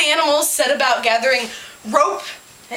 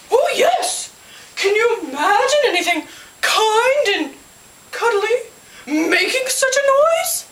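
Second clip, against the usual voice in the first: high, swooping owl voice; high-pitched, emphatic storybook owl; dramatic owl; 10 semitones higher, 4 dB louder than usual. A narrator's reading. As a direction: warn theatrically with pauses.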